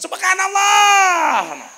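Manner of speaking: long, high wailing shout; mock chorus yell, drawn out. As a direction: bellow the correction like an exasperated congregation. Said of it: "Makmum semakin kenceng".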